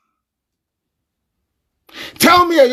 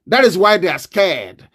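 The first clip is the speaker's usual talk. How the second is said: ordinary delivery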